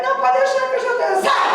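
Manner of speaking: high, rapid dismissal ending in a cry; brusque expulsion. Retold or imitated. Pastor casting out a feeble demon, brusque. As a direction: rush it high, then burst out on the last word.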